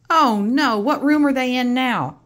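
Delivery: high, loud mock dismay; playful alarm. Parent playfully alarmed asking the child reader. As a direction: ham up the worry for the kid.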